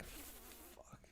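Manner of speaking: breathy whispered swear; whispered curse. Retold or imitated. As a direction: whisper it in dismay. Retold dismayed thought.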